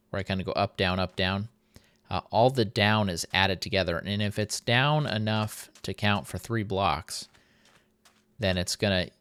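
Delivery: ordinary delivery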